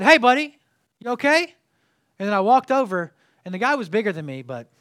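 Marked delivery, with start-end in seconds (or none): 0.0-1.5 loud, high challenge to a stranger; loud call-out. Retold or imitated. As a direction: call across to the yelling man. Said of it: "And I said".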